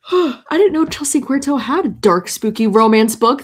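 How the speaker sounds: shocked realization; gasping surprise. Real, not acted. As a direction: caught off guard, pushing the last words out. Surprised by news of book.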